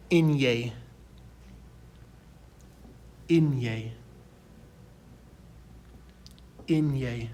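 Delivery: "inye" as "EN-yeh"; Spanish letter name. Shown as a demonstration. Teacher modelling N with a tilde, "inye" said clearly. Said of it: "this one is pronounced".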